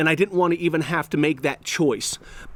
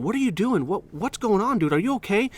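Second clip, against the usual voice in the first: concerned questioning; 6 semitones higher than usual. Retold or imitated. Teacher coaxing student in story.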